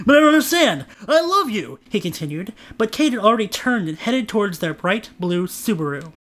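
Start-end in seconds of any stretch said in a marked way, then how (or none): 0.1-1.8 pleading. Acted. Trent pleading with Kate.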